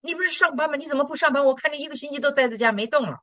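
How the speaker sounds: high, quick mock-worried kids; anxious children. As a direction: imitate the kids nagging mom. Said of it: "三个孩子很着急，说". (孩子们着急地问妈妈)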